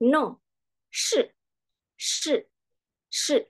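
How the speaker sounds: "no" and "shi" short, falling sharply; fourth tone. Shown as a demonstration. Teacher drills tone four, "shi" dropping like "no". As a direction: cut each "shi" off as if ending in K. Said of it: "Kemudian nada keempat".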